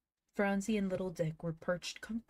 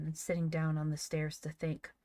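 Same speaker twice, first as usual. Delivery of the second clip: usual speaking voice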